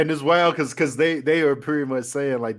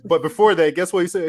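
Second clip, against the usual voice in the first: ordinary talk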